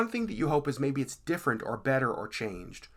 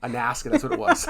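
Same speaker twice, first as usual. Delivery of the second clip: amused correction; 12 semitones higher, 4 dB louder than usual. Real, not acted. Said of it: "I don't know why I said an accent".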